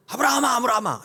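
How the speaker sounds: loud, high, alarmed shout; startled calling. Acted. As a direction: cry the name out to halt him. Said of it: "하나님이 깜짝 놀라신 거예요".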